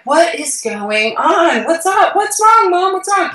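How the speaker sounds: imitating a worried daughter pleading on the phone; alarmed. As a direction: retell your own panic on the phone. Panicked daughter calling mom.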